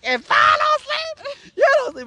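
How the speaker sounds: very high, drawn-out retold ultimatum; hammed-up high-pitched yell; shrieked threat. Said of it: "And I'm like".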